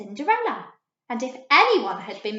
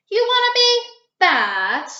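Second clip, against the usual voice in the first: wolf; 11 semitones higher, 9 dB louder than usual. A narrator's reading. Wolf voice in read story.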